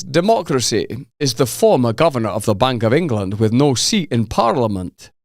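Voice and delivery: a hint of Glasgow, then drop it; Scottish accent. Quoting Galloway's jab at Carney in a light Scottish accent. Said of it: "I'm not gonna do the accent".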